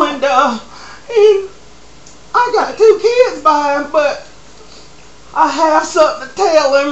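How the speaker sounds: high, whiny mock-tearful talk show guest; hammed-up weepy woman confessing; mock crying guest. Guest whimpering her confession to Maury. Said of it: "comes on there all crying".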